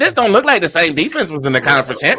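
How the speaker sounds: high, incredulous questions to his wife; asking wife in disbelief during the game; amazed. Retold or imitated. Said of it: "I said".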